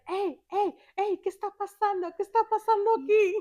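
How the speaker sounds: high, quick Spanish questioning; alarmed dad intervening. Retold or imitated. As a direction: imitate a dad breaking up a row. Dad coming upon the yelling.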